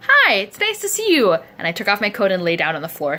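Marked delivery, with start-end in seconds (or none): 0.1-1.3 high, loud chirpy hello; hammed-up cheery hello at the door; bright mock greeting. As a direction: sing out a sweet hello. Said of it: "came over to their place".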